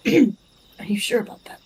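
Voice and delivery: a hushed, breathy question; mock whisper. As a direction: lean in and ask it under the breath. NPC voiced in GM narration.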